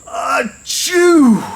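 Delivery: huge, loud, high-pitched achoo; great dragon sneeze. A narrator's reading. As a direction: sneeze explosively, long falling "choo". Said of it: "he blew a great sneeze".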